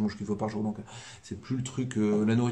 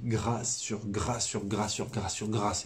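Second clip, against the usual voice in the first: « grâce » répétée lentement, chaque mot appuyé; égrène lentement « grâce sur grâce »; grâce martelée; slower than usual. Real, not acted. Insiste sur l'abondance des grâces. (insistance lente sur « grâce »)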